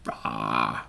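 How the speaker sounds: low mock metal rah; playful death-metal growl. Playing a metal screamer.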